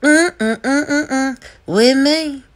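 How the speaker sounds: high, loud mock rapper warning, humming over the cut word. Mocking a rapper's warning to haters, high loud impression.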